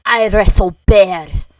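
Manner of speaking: loud, slow attempt at a Scottish accent. Friend acting soldiers who want to be real men, hammy Scottish accent. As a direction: announce it in a botched Scottish accent. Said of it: "Why are you Scottish?".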